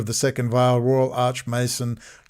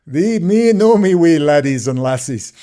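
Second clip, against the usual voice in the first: louder hammed-up Scottish brogue; mock Scottish accent; 2 semitones higher, 6 dB louder than usual. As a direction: play a jokey Scotsman talking to the lads. Mock Scottish address to listener.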